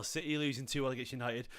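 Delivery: ordinary talk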